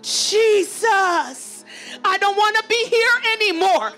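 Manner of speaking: ordinary talk, nothing marked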